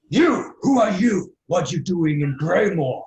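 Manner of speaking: big booming challenge to a stranger; challenging half-orc. Acted. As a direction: demand answers as a suspicious half-orc. Game master voices half-orc challenging players.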